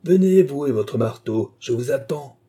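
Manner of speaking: daring the madman to come; loud dare. Acted.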